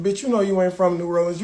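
ordinary talk

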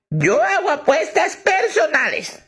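put-on high, slow old lady voice; sweet slow old lady, high. Old lady telling the manager, high and slow.

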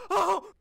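sudden high yelp of fright; startled shout. Real, not acted. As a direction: jump out of your skin. Startled by horror game.

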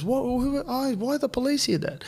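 put-on high, piping child's questioning; high-voiced flustered kid; voice of daughter. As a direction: imitate a curious little girl, pitched right up. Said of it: "My daughter runs up next to me".

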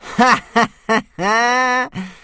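high, loud, slow mocking cackle; big mocking belly laugh; bully's cackle. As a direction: roar with laughter at the boy. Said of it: "Snotlout was laughing so hard".

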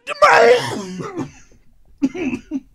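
choking. Pretend. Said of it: "you're choking on something".

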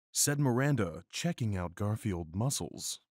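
ordinary delivery

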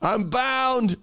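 high, strained plea of a trapped soul; crying out. Acted. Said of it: "starts crying out".

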